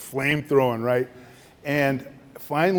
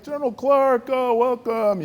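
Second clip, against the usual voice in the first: much higher, gushing welcome; effusive Egyptian host; 11 semitones higher than usual. Retold or imitated. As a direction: greet him like a beloved old friend. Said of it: "He's like".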